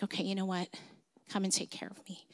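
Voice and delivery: soft, half-dead whisper of giving in; weak surrender. Retold or imitated. Half-dead daughter on the beach finally asking dad for help, whispered. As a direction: barely breathe out your surrender.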